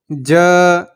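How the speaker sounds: a careful isolated ज ending on a faint आ; consonant demo. Shown as a demonstration. Teacher showing students how ज ends, a slow isolated ज. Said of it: "अगर हम इस ज को अदा करें".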